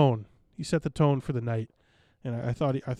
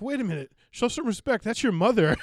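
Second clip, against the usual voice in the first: higher-pitched imitation of a comic objecting; retold protest with a raised pitch; mock indignant comeback; 8 semitones higher than usual. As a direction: lightly throw the scolding back. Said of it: "And then Dan was like".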